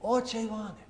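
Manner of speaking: lightly voiced call across the rail yard. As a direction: call the name into the dark down the tracks. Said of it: "He's calling out".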